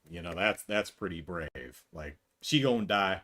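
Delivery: ordinary talk, nothing marked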